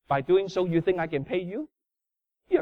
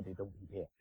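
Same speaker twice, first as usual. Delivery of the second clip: ordinary talk, nothing marked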